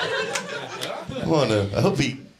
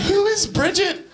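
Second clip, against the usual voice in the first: baffled; slower than usual. Retold or imitated. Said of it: "I was like".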